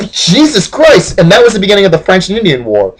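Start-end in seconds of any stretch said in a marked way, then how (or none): 0.1-1.2 high, drawn-out mock disbelief; appalled exclamation. French reacting to Washington's attack, high appalled exclamation. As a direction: draw it out, utterly appalled.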